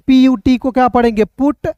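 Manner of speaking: ordinary talk, nothing marked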